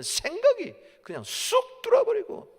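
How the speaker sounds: high, loud pulpit climax; full-force preaching. Real, not acted. Preacher recounts Satan entering Ananias, full-force preaching. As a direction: build to a shouted warning.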